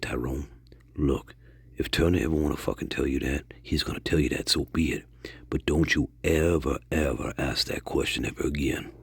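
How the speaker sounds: low, quiet, grave warning; hushed warning. Retold or imitated. Josiah warning Tyrone off a touchy question, hushed. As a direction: drop low and soft to warn them off. Cousin warns never to ask.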